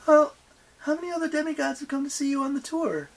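high, quick innocent question; feigned innocence. A narrator's reading. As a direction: ask sweetly as if clueless, pitched high. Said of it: "she asked innocently".

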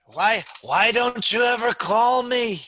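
high, loud, drawn-out motherly complaint. Acted. Pastor mimics a mother reproaching her son, nagging complaint. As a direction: wail it at a son, slow and high. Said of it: "you just have to kind of hear those dynamics".

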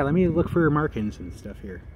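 usual speaking voice

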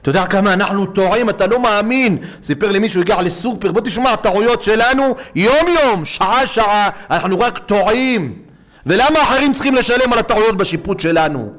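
high, loud rising sermon cry; impassioned rabbi's plea; fiery preaching. Acted. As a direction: preach it hard, building to a peak. Preacher rises to full force.

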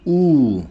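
U as a thick, back, held "oo"; thick vowel. Shown as a demonstration. Showing learners how thick letters are read, thick U. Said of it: "Kalın harfleri".

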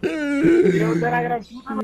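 slow, loud exasperated swearing; loud drawn-out curse. Real, not acted. Exclamación tras la jugada. (maldición lenta y a gritos)

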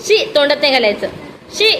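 fast, loud "don't put it in your mouth"; quick scolding. Real, not acted. Mother quickly scolding the toddler for mouthing something.

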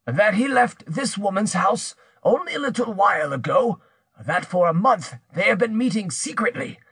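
high-pitched, insistent accuser's voice; accusing villager. Acted. Pierre tells Bertrande she is betrayed, accusing. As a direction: drive the accusation home.

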